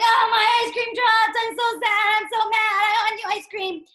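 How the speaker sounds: high, loud whiny singsong; fussy crybaby. Acted. Teacher acting a child whose ice cream dropped, sung crybaby whine. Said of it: "by crying and making a big fuss".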